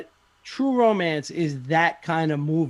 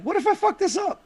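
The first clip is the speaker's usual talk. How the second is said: high anxious imitation of the director; worried Kevin Smith; 7 semitones higher, 4 dB louder than usual. Kevin Smith fretting over an offer, high and anxious. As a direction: fret in a high put-on voice. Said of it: "he's probably like".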